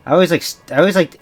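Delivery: ordinary delivery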